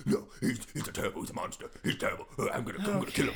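raspy, obstinate vigilante caricature; Batman. Acted. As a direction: rasp a childish vow of revenge. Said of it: "all of Alfred and Batman's scenes".

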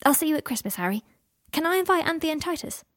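lightly voiced eager son; young boy. A narrator's reading. Scorpius says goodbye and asks Harry about guests, boyish.

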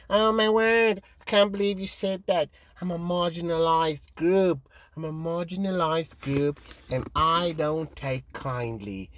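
a hint of a prissy outraged complainer; mock offended person. Mocking people who get offended, a mild whiny impression. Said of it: "everyone gets offended these days".

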